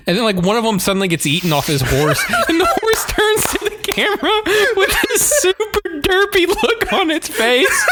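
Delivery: high, giggling through the words; can barely get it out for laughing; laughing while telling. Real, not acted. Telling the co-host about the derpy horse, laughing hard.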